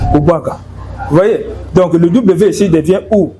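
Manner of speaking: ordinary talk, nothing marked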